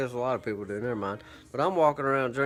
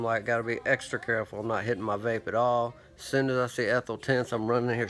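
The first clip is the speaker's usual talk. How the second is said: ordinary talk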